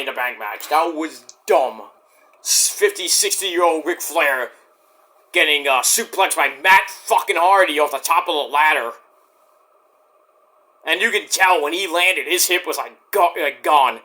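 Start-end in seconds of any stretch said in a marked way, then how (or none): none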